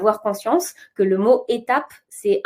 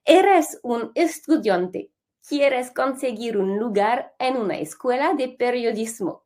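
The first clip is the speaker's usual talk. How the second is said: showy Spanish accent, carefully paced. Shown as a demonstration. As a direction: show off your best Spanish accent. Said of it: "écoutez mon bel accent".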